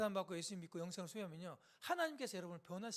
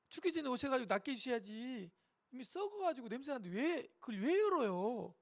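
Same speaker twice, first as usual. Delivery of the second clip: higher, plaintive objection; protesting sister; 4 semitones higher, 4 dB louder than usual. Acted. Sister objecting to opening her brother's tomb, dismayed protest. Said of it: "마리아가 얘기합니다".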